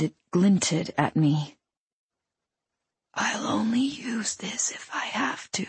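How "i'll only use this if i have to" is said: soft, airy whispered threat. A narrator's reading. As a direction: whisper a warning, eerily gentle. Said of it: "he whispered".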